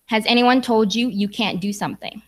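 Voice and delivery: normal talking voice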